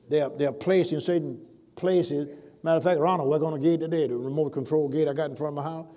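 ordinary delivery

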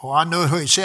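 ordinary talk, nothing marked

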